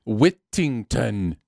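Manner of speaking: Whittington very slowly, every T hit hard; corrected T's. Shown as a demonstration. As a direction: spell out "Whittington" syllable by syllable with crisp T's. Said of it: "Yes, there we go".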